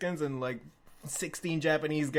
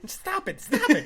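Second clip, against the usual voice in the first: much higher, building to loud laughing yell; panicked plea; 7 dB louder than usual. Retold or imitated. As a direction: beg him to stop. Narrator pleads with shouting friend.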